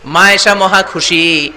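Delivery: ordinary delivery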